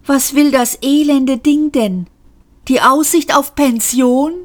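high-pitched, scornful questions; exasperated exclaiming. A narrator's reading. Passenger ridicules the fearful lift, exasperated. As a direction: call out in scornful disbelief. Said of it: "rief Seyfort".

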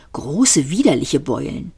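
usual speaking voice

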